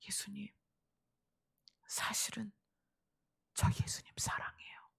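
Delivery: hushed, halting confession; very soft, with pauses between phrases; Peter. Retold or imitated. Peter answers Jesus's question, quietly confessing love. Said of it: "그래서 대답합니다".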